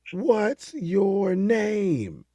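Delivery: very slow, high mock shout; hammed-up call to a far island; stretched far-off yell. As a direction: drag every word across the water. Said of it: "And then he goes".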